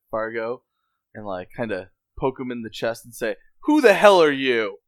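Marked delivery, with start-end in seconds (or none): 3.6-4.8 loud, raised-pitch challenge; confrontational demand. Acted. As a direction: demand it forcefully, as if poking their chest. Character confronts captive, poking chest.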